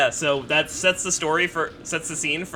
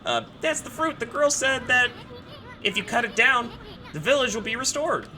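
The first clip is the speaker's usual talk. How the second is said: higher, lightly voiced sprite; bug voice attempt; 11 semitones higher, slower than usual. Shown as a demonstration. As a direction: rehearse the little bug. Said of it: "practice an Eason voice".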